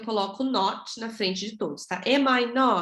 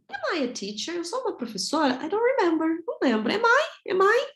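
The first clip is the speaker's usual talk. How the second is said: high-pitched playful self-doubt; mock puzzled; 10 semitones higher than usual. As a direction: question yourself in a high, bewildered voice. Acted doubt about being a teacher. (dúvida fingida, voz bem aguda)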